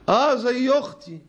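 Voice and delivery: high, slow drawn-out defence; mock casual excuse. Preacher mocking a man who calls his coworker a sister, high drawl. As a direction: drawl it out like it's nothing.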